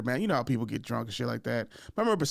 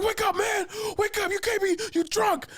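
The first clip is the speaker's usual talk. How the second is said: high-pitched shouted imitation of friend; urgent wake-up yell. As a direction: yell a sleeping drunk awake, pausing after each call. Acts out friend yelling to wake him.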